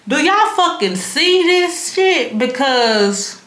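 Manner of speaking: high, slow stunned disbelief; incredulous what-is-happening; bewildered disbelief. Retold or imitated. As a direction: react like you're losing your mind. Speaker's inner bewildered reaction.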